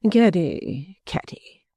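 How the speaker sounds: whispered second Caddy; mild, unbothered chiding; complacent. A narrator's reading. Mrs Jellyby answers her crying daughter, complacent. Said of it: "with the greatest complacency".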